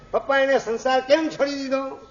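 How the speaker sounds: put-on high, childlike asking voice; a small boy tugging at his father with a question; curious little son. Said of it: "નાનો છોકરો છે". (નાના દીકરાનો ઊંચો, કુતૂહલભર્યો અવાજ)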